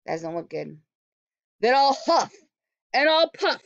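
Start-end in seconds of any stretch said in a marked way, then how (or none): 1.6-3.6 loud, higher, slow wolf threat; storybook wolf shouting at the pig's house. Acted. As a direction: bellow it through the door like a hungry wolf. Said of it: "shouted the wolf".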